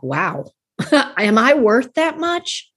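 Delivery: high, drawn-out wow with a laugh; retold amazed self-doubt. First time hiring a coach, retold amazed self-doubt. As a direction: marvel at the price, half laughing. Recalls balking at coach's price.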